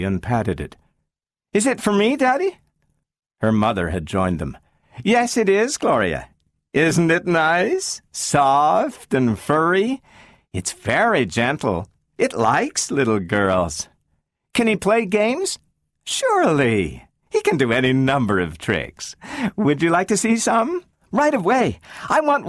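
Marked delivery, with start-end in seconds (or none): 1.5-2.6 put-on high child voice rising; girl asking if the dog is hers; hopeful little girl. Child asks about dog. 5.0-20.8 mother soothing her daughter about the dog; coaxing mother. Acted. Mother coaxes child.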